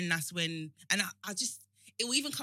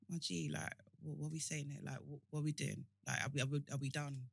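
low, hushed, unimpressed questioning; petty dismissal; 6 semitones lower, 12 dB quieter than usual. Acted. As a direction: keep it quiet and low, as if already done with the argument. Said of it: "if we're being petty".

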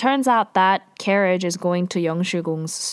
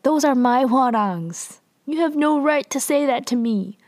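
higher-pitched mock indignation; pissed-off queen; 5 semitones higher than usual. Queen rebuking the man over her Hwarangs, retold anger. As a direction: act out an offended queen, pitched up. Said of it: "she's, like, pretty pissed".

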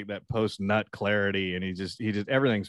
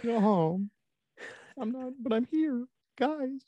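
very high, small voice; pitiful tiny planet pleading with the group; squeaky, hammed-up left-out straggler; sad little Pluto. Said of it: "being sad 'cause it wasn't a planet".

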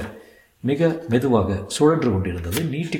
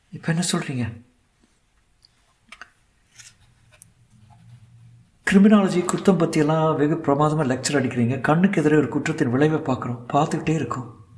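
higher woman's voice, challenging; reproachful young woman; 5 semitones higher than usual. Acted. Young woman reproaching lawyers who lecture on criminology, indignant. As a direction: take them to task in her raised pitch.

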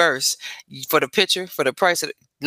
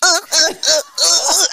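high, laughing grunts; grunting through laughter; 11 semitones higher, slower than usual. Retold or imitated. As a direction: grunt each uh while laughing. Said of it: "she was doing her back like".

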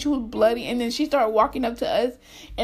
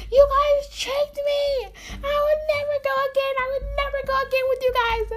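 high-pitched indignant whining; mock mad wail; 11 semitones higher than usual. Storyteller mimics friend accusing group of tricking her, mock mad. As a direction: protest repeatedly in an exaggerated high wail. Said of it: "She got so mad at all of us".

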